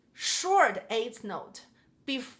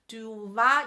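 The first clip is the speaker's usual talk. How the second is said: "du" light, "war" hit louder; accent on WAR; 5 dB louder than usual. Shown as a demonstration. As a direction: demonstrate the swing accent by stressing "war". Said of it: "the war is the accent".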